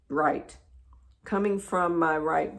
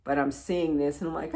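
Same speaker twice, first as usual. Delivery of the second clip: ordinary talk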